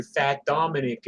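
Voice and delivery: ordinary delivery, nothing marked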